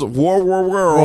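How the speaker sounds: WAR WORLD slurred into one drawn-out mouthful; tongue-tied. Real, not acted. Podcaster struggling to say War World, tongue-tied. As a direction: trip over WAR, WAR WORLD, dragging the r's together. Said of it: "I haven't said it once yet".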